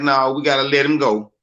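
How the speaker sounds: normal talking voice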